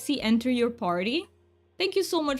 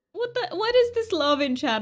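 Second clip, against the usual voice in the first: touched surprise; 9 semitones higher than usual. Real, not acted. Moved streamer sees chat support.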